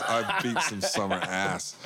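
laughing tough-guy boast. Real, not acted. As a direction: chuckle through the brag.

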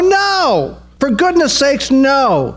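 very high, drawn-out cry of NO; emphatic refusal. Acted. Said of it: "an emphatic no".

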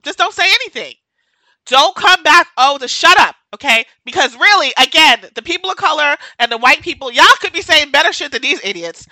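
fired-up scolding; angry rant. Real, not acted. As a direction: scold them loudly. Ranting at critics, emotional.

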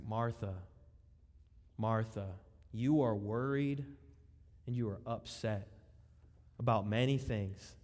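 calm her down gently; a gentle Jesus soothing an upset Martha. Retold or imitated. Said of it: "so gently".

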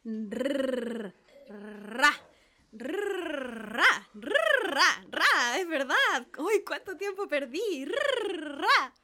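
trilling Rrr, ra with relish; pupil rolling R. Acted. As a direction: practise the rolled R. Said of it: "especie de temblor".

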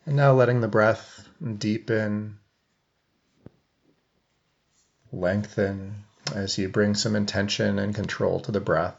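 even meditation voice with long silences; guided meditation. Real, not acted. Coach leading seated listeners into breath awareness, guided meditation. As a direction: guide them gently, leaving long pauses between phrases.

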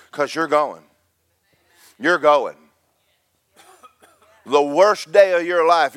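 high, loud revival preacher delivery; exultant pulpit proclamation; fired-up preaching. Real, not acted.

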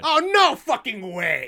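high, loud, drawn-out protest; outraged shout. Acted. Player hearing the motorcycle engine start up, outraged shout. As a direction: shout it in disbelief, stretching the words.

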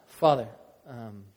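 soft, hushed prayer over music; closing prayer. Real, not acted. Pastor praying over the congregation, soft and hushed. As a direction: pray quietly and earnestly.

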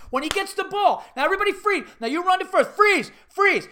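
high, loud coach commands; drilling coach. Retold or imitated. Coach running a freeze drill, frantic hollering. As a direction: shout out the drill over the field. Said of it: "He goes".

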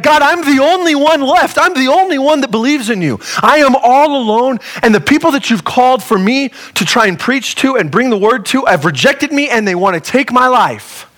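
hammed-up sulking complaint to God; temper tantrum. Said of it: "having this temper tantrum".

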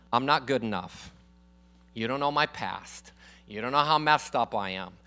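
low, halting self-doubt, quietly voiced; imitated fearful excuses. Preacher voicing members' reasons not to serve, mildly fearful. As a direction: voice their insecurity in a low, subdued tone. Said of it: "because of fear".

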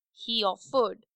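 ordinary delivery

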